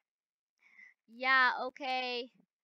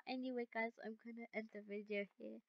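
winding down; 3 semitones lower, 19 dB quieter, faster than usual. Real, not acted. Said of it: "I can't stop laughing".

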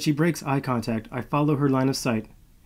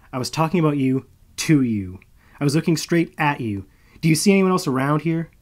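louder and faster as the rant builds; angry retort; 5 semitones higher, 7 dB louder than usual. Acted. Malo rounds on Marcus, building to an angry shout. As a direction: throw it at him, getting louder.

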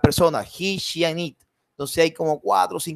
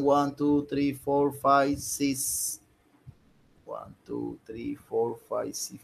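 low, very soft count; counting to oneself; 3 semitones lower, 5 dB quieter, much slower than usual. Real, not acted. Teacher tallying who has finished, counting to himself. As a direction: count under your breath, low and soft.

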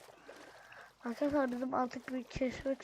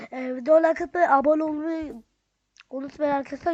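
much louder and higher call to viewers; loud plea; 3 semitones higher, 13 dB louder than usual. Real, not acted. Telling viewers to like and subscribe, loud and high. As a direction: belt out the like-and-subscribe reminder.

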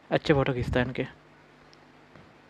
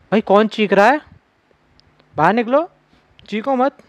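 higher, alarmed calling out; scared shout; 6 semitones higher than usual. Real, not acted. Gamer shouts at an unseen screamer, alarmed. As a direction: yell back at the screaming.

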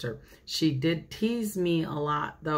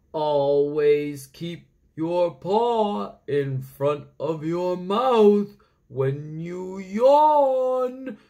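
slow, loud owl voice, drawn out; hooting owl; 5 dB louder, much slower than usual. Acted. Owl telling the rabbit off, slow and hooting. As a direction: lecture like a wise old owl. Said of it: "hooted the owl".

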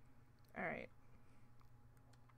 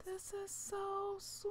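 tender; 8 semitones higher, slower than usual. Real, not acted. Tender over the gift.

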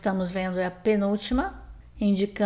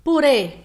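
Purê, higher and louder, closed final ê; stressed final syllable; 5 semitones higher, 7 dB louder than usual. Shown as a demonstration. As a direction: punch the closed ê ending of Purê. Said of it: "sílaba tônica com som fechado". (Purê com ê final tônico e fechado)

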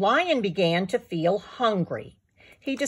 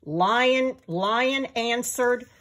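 ordinary delivery, nothing marked

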